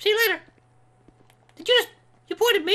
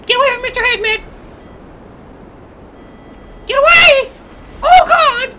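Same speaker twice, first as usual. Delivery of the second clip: loud, high shouts of alarm; panicked yelling; 5 semitones higher, 10 dB louder than usual. Real, not acted. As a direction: yell to drive the danger away. Fleeing danger in the game.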